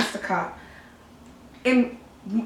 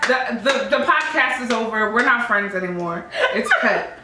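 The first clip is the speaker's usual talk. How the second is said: ordinary delivery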